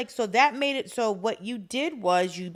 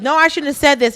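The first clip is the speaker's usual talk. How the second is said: mock panic; 4 semitones higher, 12 dB louder, faster than usual. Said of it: "they're like".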